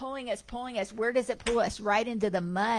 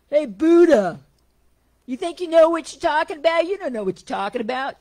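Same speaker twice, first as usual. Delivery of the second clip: loud, high, fast jeering heckle; heckler shouting; 8 semitones higher, 14 dB louder than usual. Retold or imitated. Heckler in the crowd taunting the Buddha, loud mocking shout. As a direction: bark it at him from the back row.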